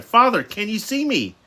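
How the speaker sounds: high-pitched, urgent question; crying out. A narrator's reading. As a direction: cry out anxiously to father. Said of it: "she cried".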